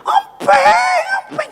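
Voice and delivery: very high screamed wail; imitated toddler scream. Toddler crying to papa for his pacifier, imitated screaming wail. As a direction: shriek like a crying toddler demanding. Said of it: "Udah kayak ada yang meninggal nangisnya".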